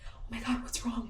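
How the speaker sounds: lightly voiced worried partner; concerned husband. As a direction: imitate him rushing over concerned. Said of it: "He comes running over and he's like".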